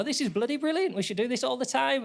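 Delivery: mock delighted. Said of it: "you go".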